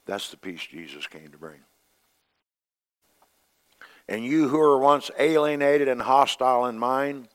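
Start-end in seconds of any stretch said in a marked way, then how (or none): 4.1-7.3 high, loud preached reading; fiery pulpit scripture reading; preaching scripture. Acted. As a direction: proclaim the verse to the church. Reads scripture at full force.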